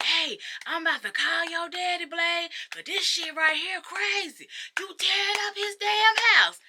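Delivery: high-pitched neighbor calling out; imitated scolding neighbor. Acts neighbor calling out angrily.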